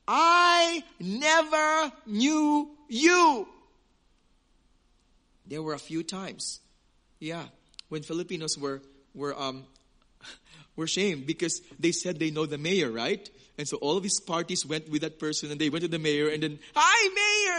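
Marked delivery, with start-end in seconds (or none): 0.1-3.5 very slow, loud, ringing pronouncement; solemn declaration. Acted. A preacher proclaims Jesus rejecting false disciples, slowly. 16.7-17.6 high, loud overeager hello; eager greeting. Retold or imitated. A preacher acts out a Filipino greeting the mayor too eagerly.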